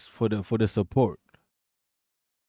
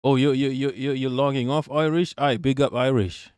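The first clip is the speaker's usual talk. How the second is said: usual speaking voice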